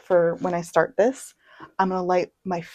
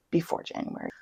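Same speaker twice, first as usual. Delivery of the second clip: usual speaking voice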